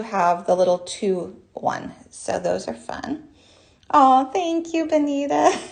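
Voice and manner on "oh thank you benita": bright, high, louder thank-you to a viewer; light up as you thank her; excited thanks. Real, not acted. Host thanks a live chat viewer, delighted and high.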